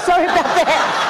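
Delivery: real laugh at her own slip; laughing apology. Real, not acted. Apologizing to the audience for leaking a name, laughing.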